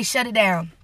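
a low, settled divine order; voice of the Lord. Retold or imitated. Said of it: "the Lord would say".

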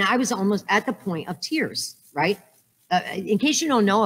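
ordinary talk, nothing marked

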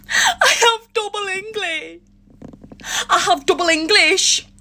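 comic whine.

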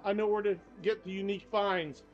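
normal talking voice

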